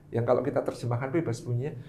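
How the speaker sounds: ordinary talk, nothing marked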